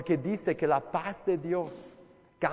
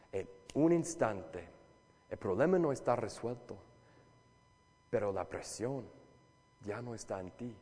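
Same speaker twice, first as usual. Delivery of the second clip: lower, softer, slow promise; soft reassurance; 6 semitones lower, 4 dB quieter than usual. Acted. Preacher calming anxious listeners, slow and soft. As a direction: let the word paz settle gently.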